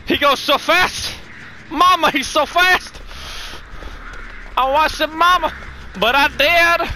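high, frightened southern drawl. Pretend. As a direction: wail to mama with a southern drawl. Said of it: "That's a southern drawl coming out".

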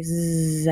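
"Z" as a long buzzing zzz; Z sound. Shown as a demonstration. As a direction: hold the buzz of Z. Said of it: "that S is going to say".

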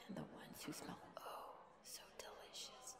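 hungry, savouring whisper; secretive, relishing murmur under the breath; whispered aside. Acted. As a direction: drop to a very soft whisper, as if to yourself. Aside hungrily, then covers it.

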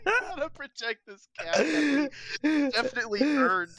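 laughing through the words; cracking up in disbelief; shocked laughter. Real, not acted. Absurd lucky dice result.

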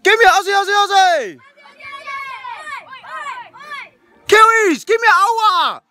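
high-pitched crowd-rousing chant; cheerleading call. Real, not acted. Host calls on Aussies and Kiwis for their cheers, rousing call. Leading both teams' cheers.

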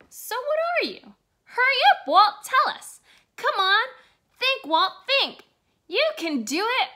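nagging him to answer fast; impatient urging. Acted. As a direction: hurry him along. Friends pressing Walt to answer.

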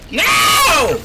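high, loud terrified cries; panicking gamer. Real, not acted. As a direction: cry out in terror. Killer spots him.

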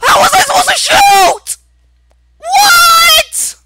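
high screaming rage; real gamer rage, full-volume shrieking; enraged outburst. Real, not acted. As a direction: scream in outraged disbelief at the game. Shot fails, player rages at game.

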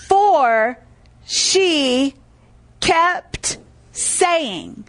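slow, deliberate, spaced-out words; word-by-word emphasis. Real, not acted. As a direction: land each word separately, with pauses. Preacher spelling out verse for emphasis.

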